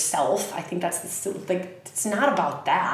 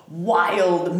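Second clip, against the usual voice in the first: usual speaking voice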